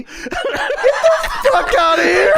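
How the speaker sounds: high, slow cry of laughing disbelief. Real, not acted. Co-host mocking the Cookie entrance, laughing in disbelief. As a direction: crack up on a drawn-out, high outburst. Laughing outburst at the retold line.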